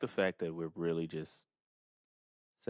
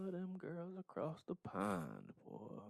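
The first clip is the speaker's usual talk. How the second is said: slow, soft, lazy country drawl; slow drawled tease; 10 semitones higher, 7 dB quieter, much slower than usual. Pretend. Teasing cohost about loving UK ladies, slow drawl. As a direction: stretch it out like a good old boy.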